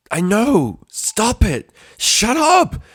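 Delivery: high, loud desperate scream; a shriek of raw frustration. A narrator's reading. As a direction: scream it at the beeping machine. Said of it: "she screamed".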